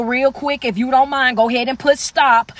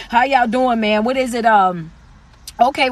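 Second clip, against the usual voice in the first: usual speaking voice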